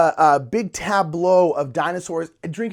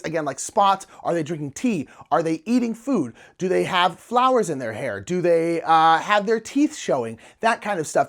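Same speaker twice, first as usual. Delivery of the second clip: ordinary talk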